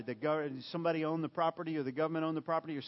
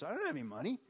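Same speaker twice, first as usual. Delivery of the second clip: Peter, serious; 8 semitones higher, much faster than usual. Retold or imitated. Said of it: "I think he was serious".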